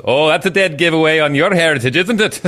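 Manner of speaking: light imitation of an English accent; a hint of an English accent. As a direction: mimic the wife's English accent, teasing. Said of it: "lovely English accent".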